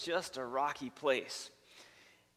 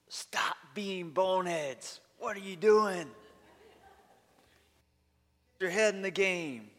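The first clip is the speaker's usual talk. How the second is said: fired-up coach yell; 5 semitones higher, 4 dB louder than usual. Retold or imitated. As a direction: coach chewing out his team at halftime. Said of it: "He's fired up".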